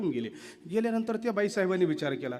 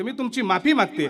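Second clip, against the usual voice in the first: higher, louder plea for forgiveness; earnest apologetic wife; pleading wife; 6 semitones higher, 7 dB louder than usual. Retold or imitated. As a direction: beg the rich man to let him go. Wife begs sethji to release husband.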